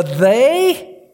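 "they" lifted high and loud with a rise; emphatic they. Shown as a demonstration. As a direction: make "they" ring out as the key word. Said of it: "is emphatic".

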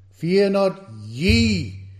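ye stressed, slow and falling. Shown as a demonstration. Preacher demonstrates the stress on ye for the congregation, slow emphatic. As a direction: press hard on ye, slowly. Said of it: "there is meant to be an emphasis on that word, ye".

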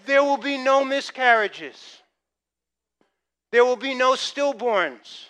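loud, slow, ringing proclamation; booming pulpit promise; preaching at full force. Acted. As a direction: declare it like a prophet. Preaching declaration.

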